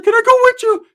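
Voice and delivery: put-on very high squeaky kid voice; high loud little-kid plea; kid Ricky. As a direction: squeak it out like an eager little boy. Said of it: "Ricky's like".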